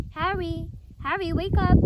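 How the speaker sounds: urgent calling. A narrator's reading. Lupin waking Harry urgently.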